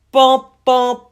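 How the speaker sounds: loud sung dun-dun; ominous musical sting, belted; dramatic sting. Acted.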